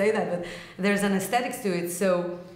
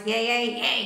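twangy distorted pirate cry; 3 semitones higher than usual. Shown as a demonstration. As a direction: find the grit in a pirate voice. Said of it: "pirate voice".

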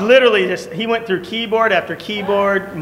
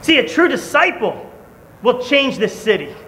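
preaching; 6 semitones higher than usual. Acted. Preacher urging the congregation to change their world, building fervor. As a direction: preach it with growing fire.

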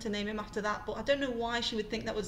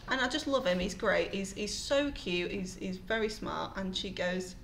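normal talking voice

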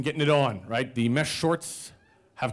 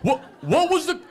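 high, loud, stammering outrage; acted indignant interrogation; exasperated demand; 12 semitones higher, 5 dB louder than usual. Berates roommate over code word.

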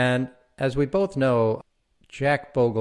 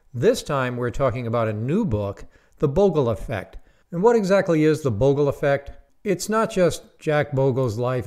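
upbeat host intro, a bit higher and louder; podcast intro read; 4 semitones higher, 3 dB louder than usual. Acted. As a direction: announce the guest like a show opener.

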